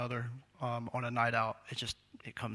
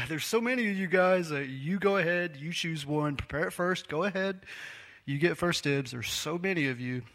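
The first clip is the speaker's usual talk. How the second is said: sarcastic Elijah; 4 semitones higher than usual. Retold or imitated. Elijah mocking the many prophets of Baal, sarcastic. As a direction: wave them ahead with heavy sarcasm. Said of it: "do you hear the sarcasm".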